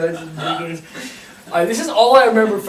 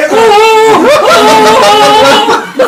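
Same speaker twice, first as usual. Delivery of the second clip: long, high, loud mock howls; slow hooting whoas at full volume; panicked wailing; 12 semitones higher, 9 dB louder, much slower than usual. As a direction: imitate a trapped man wailing for help. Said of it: "Phil is still in there going".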